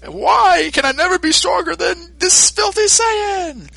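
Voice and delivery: high, slow, bitter wail; anguished villain cry. Retold or imitated. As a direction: rail at the sky in frustration. Frieza despairing at defeat.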